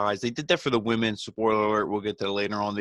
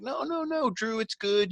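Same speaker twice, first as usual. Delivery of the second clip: much higher, falsetto-ish fake reassurance; mocking heel voice; 13 semitones higher than usual. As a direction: squeak it out like a smarmy heel soothing the champ. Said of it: "They're like".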